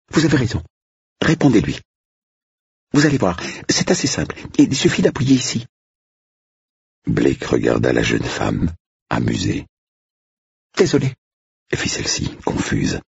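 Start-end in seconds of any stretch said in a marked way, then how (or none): 0.1-5.7 put-on high, eager young woman's voice; a nervous young bride-to-be, pitched up; flustered young woman. 10.8-11.1 put-on high, eager young woman's voice; a nervous young bride-to-be, pitched up; flustered young woman.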